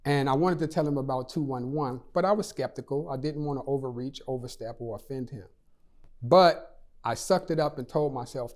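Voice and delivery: ordinary talk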